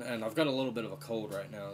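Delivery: ordinary talk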